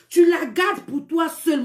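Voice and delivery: drawn-out, stressed "Tu la gardes pour toi seul"; emphatic scolding. Real, not acted. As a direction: stretch each word of "Tu la gardes pour toi seul" as a firm order. Scolding men, repeats order slowly.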